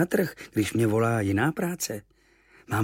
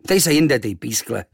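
louder dismissive bark; mild innkeeper bark; gruff dismissal; 6 dB louder than usual. Acted. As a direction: brush the kid off. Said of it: "houkl na mě".

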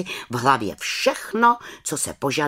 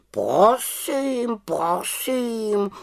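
high, drawn-out huffy croak; offended raven; 11 semitones higher, much slower than usual. A narrator's reading. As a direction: stretch each word like a raven taking offence. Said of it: "urazil se Abraxas". (uraženě, havraním hlasem)

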